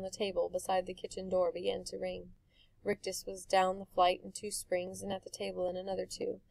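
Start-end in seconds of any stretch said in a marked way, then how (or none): none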